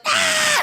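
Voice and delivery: very high mock scream; witch's scream. Imitating the witch charging with a spear, high scream. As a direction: imitate a shrieking attack cry. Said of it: "she has got that scream".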